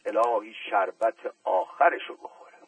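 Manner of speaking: ordinary delivery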